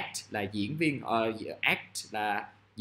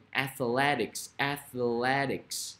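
very slow, drawn-out syllables; slow word demonstration; much slower than usual. Shown as a demonstration. Teacher models athletics for students, slowly and deliberately. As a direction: sound out athletics slowly for learners.

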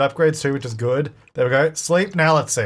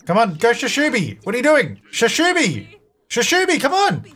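high-pitched frantic coaxing; exasperated, high cheering on; urgent urging; 9 semitones higher than usual. Real, not acted. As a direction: plead with the character to hurry. Urging game character to hurry.

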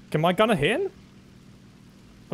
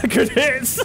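higher, through real laughter; giggly and pitched up; laughing; 7 semitones higher than usual. Real, not acted. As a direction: crack up as you say it. Same words amid laughing fit.

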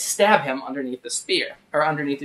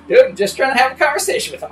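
higher, louder, faster retold protest; exasperated protest; 10 semitones higher, 7 dB louder, faster than usual. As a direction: blurt it out defensively, fast and loud. Retold defending himself to friend.

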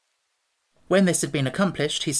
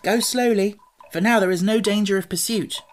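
put-on raised-pitch boyish character voice; Tip, instructing; 6 semitones higher, 4 dB louder than usual. A narrator's reading. Boy to his wooden steed, lightly voiced and instructing.

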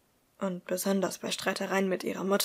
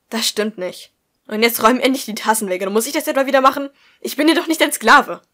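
angry shout; 3 semitones higher, 13 dB louder than usual. Acted. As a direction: scream it, in a foul mood. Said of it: "Catherines Ton machte nur zu gut deutlich". (Mutter brüllt wütend die Tochter an)

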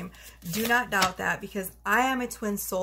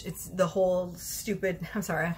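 ordinary delivery

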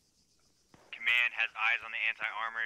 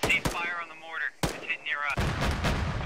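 shouting orders over battle; 6 semitones higher, 4 dB louder, slower than usual. Real, not acted. Radio calls during battle.

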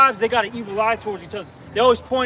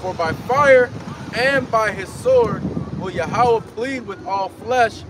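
preaching scripture; 3 semitones lower, slower than usual. Acted. Street preacher reading Isaiah to the crowd, slow and booming. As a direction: proclaim each line of Isaiah slowly.

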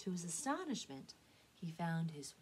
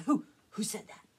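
loud, angry stammered challenge; angry demand; 9 dB louder than usual. Acted. Drake challenging the unseen speaker, angry. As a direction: demand it sharply, voice raised. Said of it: "Drake angrily demanded".

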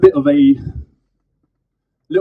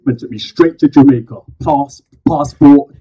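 spoken word poem; 7 dB louder than usual. Acted. Poet performing to a live crowd, loud slam declamation. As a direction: declaim each phrase to the crowd.

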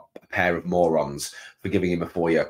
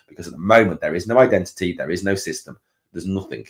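usual speaking voice

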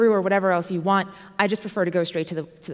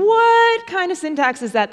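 mock bafflement; 8 semitones higher, 5 dB louder than usual. Voicing a baffled audience member.

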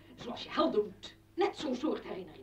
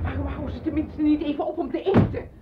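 loud, higher, fed-up outburst; fed up with the racket outside; exasperated complaint; 6 semitones higher, 12 dB louder than usual. Acted. Exasperated complaint about the noise.

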